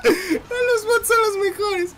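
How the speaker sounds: high, slow words squeezed out through laughter; can barely speak for laughing; laughing hard. Real, not acted. Gamer cracking up as the bots fight each other, laughing.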